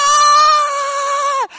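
very high loud cry of joy; hammed-up joyful yell; joyful shout. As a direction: burst out in triumph. Healed man's joyful shout.

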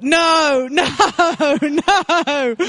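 high laughing protest; half-laughing mock panic. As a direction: laugh through the nos in disbelief.

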